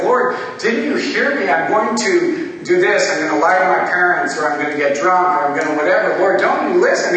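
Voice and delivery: loud, insistent complaint to God; frustrated prayer. Retold or imitated. Man in prayer pesters the Lord, frustrated. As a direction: push each line harder, demanding to be heard. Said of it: "just being frustrated".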